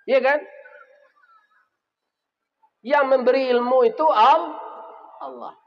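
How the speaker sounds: high, loud scolding sermon; preacher thundering at doctors in the congregation, loud and high; fiery preaching. Acted. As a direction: rebuke the greedy doctors from the pulpit. Preacher scolds doctors at full force.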